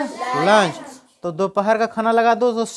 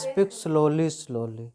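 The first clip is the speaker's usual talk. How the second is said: fading away; 5 semitones lower, 8 dB quieter, much slower than usual. Shown as a demonstration. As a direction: lower and softer, then nearly gone. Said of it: "और धीरे धीरे बोलो". (धीमे होते हुए गायब)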